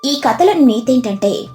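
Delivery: ordinary delivery, nothing marked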